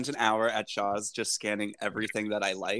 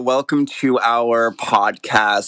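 podcast intro; 8 dB louder, much slower than usual. Acted. Podcast opening greeting.

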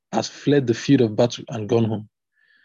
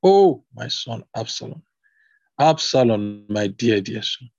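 ordinary delivery, nothing marked

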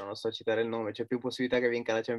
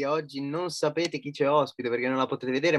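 normal talking voice

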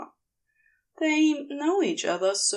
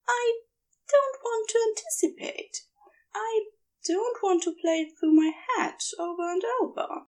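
raised, earnest, unsure angel voice; pleading angel; 9 semitones higher than usual. Acted. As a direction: ask to be told what to do, hesitantly.

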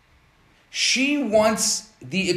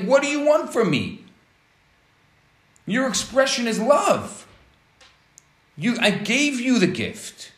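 much higher, indignant protest; exasperated husband; 6 semitones higher than usual. Retold or imitated. Husband protesting to his wife, higher and exasperated. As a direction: throw up your hands, I gave you the gift.

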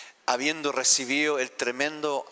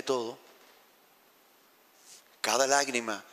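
voice of the Father. Acted. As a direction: speak as a tender Father reassuring his child. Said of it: "el Padre nos dice".

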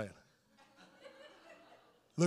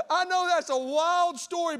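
ordinary talk, nothing marked

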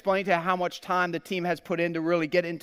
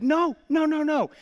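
high, mock-emphatic wifely veto; alarmed wife's refusal; 5 semitones higher, slower than usual. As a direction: imitate a wife putting her foot down, drawing out the words.

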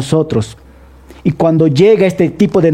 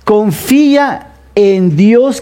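slow, deliberate reading of the verse; slow, weighty scripture reading; read slowly; 3 semitones higher, much slower than usual. Shown as a demonstration. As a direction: draw each word out for the congregation. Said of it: "y lo voy a leer despacio".

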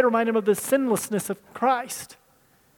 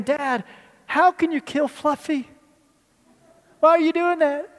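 put-on higher, rising child's plea; pleading child; 5 semitones higher than usual. Children begging dad to spare the lamb, pleading higher. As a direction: beg like a heartbroken kid.

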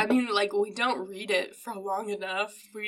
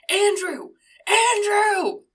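high, loud name holler, building; hammed-up bellow, second one bigger; imitated parent yelling; 10 semitones higher, 9 dB louder, slower than usual. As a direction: try the name out as a yell. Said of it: "they yell at first".

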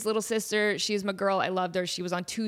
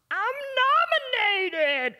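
excited gasp; 3 dB louder than usual. Retold or imitated. Recalling her Kids' Choice nomination, gasp then thrilled cry.

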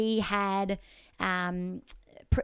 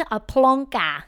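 louder, drawn-out Scottish "plonker"; exaggerated mock Scottish; 4 semitones higher, 9 dB louder, slower than usual. Host savouring guest's Scottish slang, exaggerated mock Scottish. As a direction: relish the Scottish word, big and slow.

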